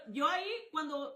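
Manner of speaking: ordinary talk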